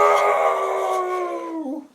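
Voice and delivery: high, loud shouted no; bad Santa yell. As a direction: bellow it like an angry Santa. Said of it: "Bad Santa yells".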